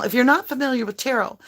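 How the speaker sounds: ordinary talk, nothing marked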